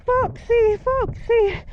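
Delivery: high, panicked calling out; reenacted panic. Rider calling to friend as horse sinks, high panicked cries. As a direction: relive the terror of the sinking horse. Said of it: "shouting to my friend".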